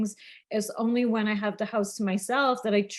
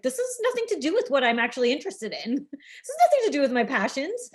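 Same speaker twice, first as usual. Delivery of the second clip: high-pitched exasperated rant, building louder; mock exasperation; 7 semitones higher, 3 dB louder, faster than usual. Podcaster acting out agitation at being pulled from their passions, mock exasperated. Voices own agitation at interruptions.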